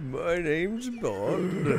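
put-on slow, high old man voice; old man James Bond. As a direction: drag it out like a doddering old man. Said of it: "I'm doing an old man voice".

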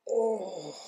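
high groan at a wave of heat; groaning as the burn hits; pained groan. Real, not acted. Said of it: "I'm having a contraction right now".

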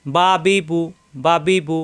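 "বা বি বু" with short vowels, both lips closing; don't stretch "বা বি বু"; three short syllables, "বা বি বু"; quick short vowels. Shown as a demonstration. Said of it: "হারকাতের উচ্চারণ তাড়াতাড়ি".

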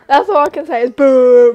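loud jeering boo. Real, not acted. Streamer booing the sims again, loud. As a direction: call it out like a heckler.

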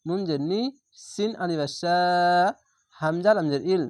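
usual speaking voice

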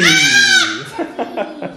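high shrieked laugh; squealing laugh after the game; shrieking laugh. Real, not acted.

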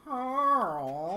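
loud, high drawn-out call. Pretend. Player hollering for Carl in the game, drawn-out call. Calling out a character's name.

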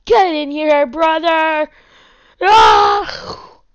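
loud high Viking yell; battle cry. Acted. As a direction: charge in roaring.

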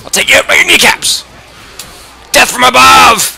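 high wild war shout; battle cry. Real, not acted. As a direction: charge in screaming it.

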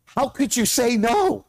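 high-pitched incredulous question; mock incredulity. Second-guessing Barak in hindsight, high mock incredulity. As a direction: ask it high, as if baffled by the refusal.

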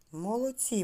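ordinary delivery

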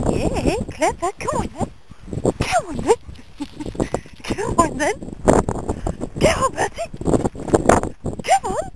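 high sing-song dog talk; squeaky praising a dog. Real, not acted. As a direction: coo up high like talking to a puppy.

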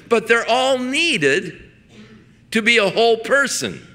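impassioned preacher hammering each word; building sermon. Acted. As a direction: drive the point home with growing force.

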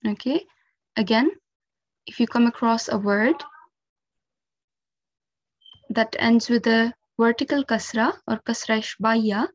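normal talking voice

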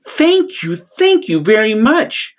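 high, playful bubbling thanks; bubbly thanks. Acted. Little blue fish thanking Rainbow Fish, high and bubbly. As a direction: gush thanks happily. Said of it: "bubbled playfully".

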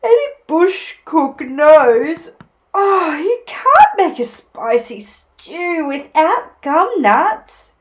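high, loud, drawn-out sleepy advice; hammed-up drowsy know-it-all koala; yawning Koala. A narrator's reading. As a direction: stretch words as if mid-yawn. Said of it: "he yawned".

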